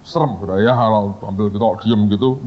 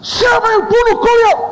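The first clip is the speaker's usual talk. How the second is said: very high, theatrical demanding yell; comic high-pitched outburst; mock angry interrogation; 4 dB louder than usual. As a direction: demand it like an exploding boss, hammed up. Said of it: "marahnya meledak".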